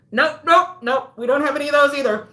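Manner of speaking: exasperated interrupter; interrupting guy. Retold or imitated. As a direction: cut in fast and loud. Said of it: "the guy would come in and he would go".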